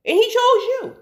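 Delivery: high, emphatic retold exhortation; impassioned exhortation. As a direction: drive the point home, voice lifted high. Driving home point to mother.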